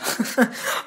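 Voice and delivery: short, breathy chuckle; quiet little burst of laughter; real amused laugh. Real, not acted. YouTuber amused by naming Condiment King as favourite villain, amused chuckle. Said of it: "क्यों मैं इतना ज्यादा हंस रहा हूँ".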